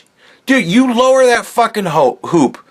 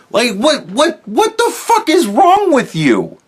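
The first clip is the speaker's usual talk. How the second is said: high, drawn-out disbelief; incredulous outrage; 6 semitones higher than usual. Real, not acted. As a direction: stretch it out, stunned and appalled. Outraged at a commenter.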